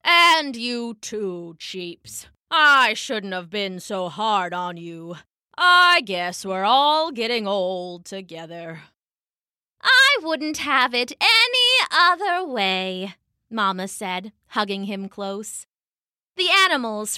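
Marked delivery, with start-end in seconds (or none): 0.0-8.8 put-on slow, low old-man voice; rueful Papa. A narrator's reading. Papa admits to his animals he was too hard, rueful. 9.8-13.1 high, slow, tender Mama voice; loving Mama. A narrator's reading. Mama hugs Papa close, loving.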